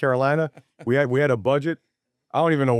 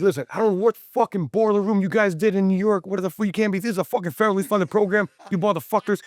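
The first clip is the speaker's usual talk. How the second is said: retold tirade; 7 semitones higher than usual. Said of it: "He, like, went off on me".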